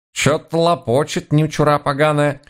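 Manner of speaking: put-on high-pitched old-woman voice through a polite smile; granny's muttered aside. A narrator's reading. Granny comments on the foreigners behind a smile, high muttered aside. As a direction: grumble it sideways, high, keeping a smile. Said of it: "не снимая вежливой улыбки, прошептала бабка".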